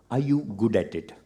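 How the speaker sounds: ordinary delivery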